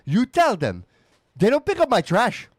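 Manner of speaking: put-on high, loud complaining old-mom voice; imitating mom. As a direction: ham up your mom griping about the trash pickup. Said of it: "That's a terrible accent of my mom".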